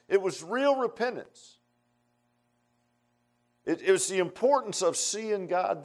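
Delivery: high-pitched, exalting pulpit delivery; impassioned preaching. Real, not acted. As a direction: lift each phrase high, preaching with fervor. Preacher exalting the vision of God.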